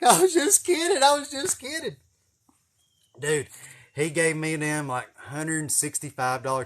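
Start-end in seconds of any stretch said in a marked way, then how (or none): 0.0-2.0 high, loud frantic backpedal; terrified sixteen-year-old pleading; panicked pleading. Retold or imitated. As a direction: squeak it out like a scared teen. Scared kid backpedaling to trooper.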